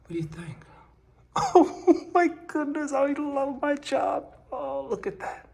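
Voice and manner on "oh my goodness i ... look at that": high falsetto squeal of delight; playfully thrilled, way up high; giddy gushing. Pretend. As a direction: gush in a high put-on voice. Gushing over own painting work.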